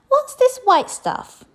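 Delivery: put-on high, slow child's question; a small girl puzzled by a postcard; squeaky wondering princess; little princess voice. A narrator's reading. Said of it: "the little princess asked".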